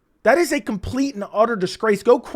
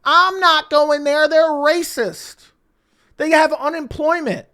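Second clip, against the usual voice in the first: high, very slow whiny mockery of Strickland; mock crybaby; 7 semitones higher, slower than usual. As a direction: whine it like a sulking child, stretching every word. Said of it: "Sean Strickland is crying".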